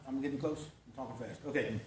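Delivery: low, soft aside to yourself; said under the breath; quiet aside. Real, not acted. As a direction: drop the voice well down for a private check. Muttered aside to himself mid-lesson.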